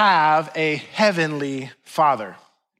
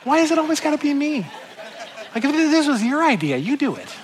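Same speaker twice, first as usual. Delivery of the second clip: exasperated protest; 9 semitones higher than usual. Retold or imitated. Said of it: "And I'm like".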